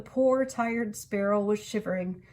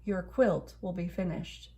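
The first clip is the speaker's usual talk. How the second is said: usual speaking voice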